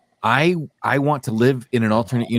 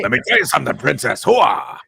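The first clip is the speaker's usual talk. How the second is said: higher, brash Pacino; 3 semitones higher than usual. Retold or imitated. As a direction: Pacino bravado, ending in a hooah. Pacino as Han Solo.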